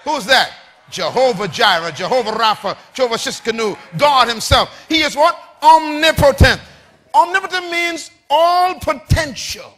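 loud, building sermon cry; rousing preacher declamation; full-force preaching. Real, not acted. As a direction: proclaim it to the congregation, rising to a shout.

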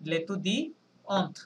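লেতুদিয়ন্ট slowly broken up, ending on T; sounded final T. Shown as a demonstration. Teacher repeats the feminine form for students, slow with sounded T. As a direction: draw out লেতুদিয়ন্ট and finish with an audible T. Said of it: "টি পর্যন্ত উচ্চারণ করতে হবে".

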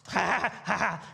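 a light imitation of children laughing; imitated kids laughing. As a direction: imitate the kids giggling in their room. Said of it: "they're laughing and they're playing".

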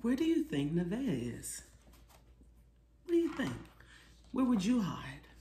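plain reading voice

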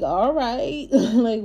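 laughing. Real, not acted. Laughing at the reading.

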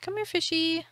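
high sing-song come here fishy; talking to fish. Real, not acted. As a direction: coax the fish like a pet. Calling a fish.

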